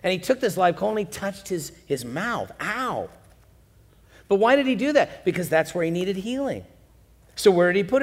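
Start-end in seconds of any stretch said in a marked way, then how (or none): 2.6-3.1 mock ow of pain. Coal touches the mouth.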